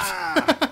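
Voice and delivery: sudden high loud yelp; startled yelp. Real, not acted. Player yelping when the security answers fail, in a sudden high yelp.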